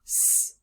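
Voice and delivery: "s" as a sustained hiss; long "sss" for the letter "s"; isolated sound. Shown as a demonstration. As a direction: hiss "s" on its own. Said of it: "look at the fourth syllable".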